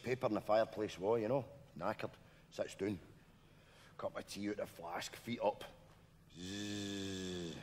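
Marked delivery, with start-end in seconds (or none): 6.4-7.5 1 mock snore. Imitating cousin sleeping.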